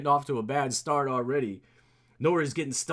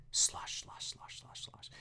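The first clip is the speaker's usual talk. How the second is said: whispered slosh; 10 dB quieter, slower than usual. Acted. Mimicking sloshing sound.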